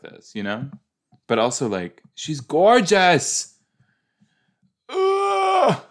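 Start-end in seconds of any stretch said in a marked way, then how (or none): 2.1-3.6 slow, loud, high gush. Real, not acted. Gushing over actress's looks. 4.9-5.8 very high loud cry of delight; overwhelmed squeal. Real, not acted. Overwhelmed by her beauty.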